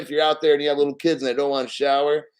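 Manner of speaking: normal talking voice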